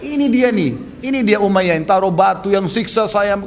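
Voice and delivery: high, loud accusing cry; acted denunciation. Bilal points out his torturer Umayyah to allies, loudly accusing. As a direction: denounce him to rouse the others.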